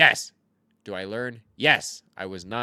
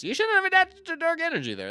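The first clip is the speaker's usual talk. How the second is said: mocking high voice; 5 dB louder, faster than usual. Mocking the opponent's misplay.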